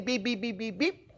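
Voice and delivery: high, soft imitated beeps; thermometer beeps. Cook imitating the thermometer's warning, high soft beeps. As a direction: mimic a thermometer alarm lightly.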